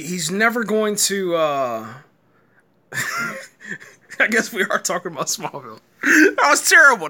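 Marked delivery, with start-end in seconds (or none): 6.0-7.1 loud laughing outburst on terrible; bursting out laughing through the words; cracking up at his own joke; laughing loudly. Real, not acted. Laughing at his own bad pitch.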